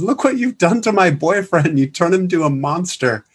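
high, mock-accusing girlfriend voice; imitated upset girlfriend. Girl confronting the guitarist over her boyfriend, high imitated accusation. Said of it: "in a French accent that I don't even wanna try".

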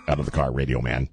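low deadpan police command; mock cop order. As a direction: play the stern officer, voice dropped low. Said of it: "pitch-perfect imitation of him".

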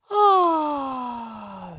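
high cry sliding slowly down; drawn-out exhale of mock bliss; long satisfied "ah". Host savours his own mom joke, long gratified sigh.

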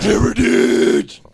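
loud, drawn-out roar; mock battle shout. Co-host echoes the word for listeners, loud roar. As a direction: bellow it, stretched long.

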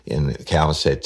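ordinary delivery

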